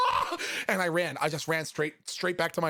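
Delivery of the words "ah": high fleeing yell; panicked scream. Retold or imitated. Man fleeing back to his room, panicked scream. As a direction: yelp and bolt. Flees after panicked apology.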